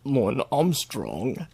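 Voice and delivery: put-on slow careful copy of "Lauren Armstrong" in his accent; imitating his accent. Trying to copy the arrestee's accent, slow. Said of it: "I can't even try to say it like you do".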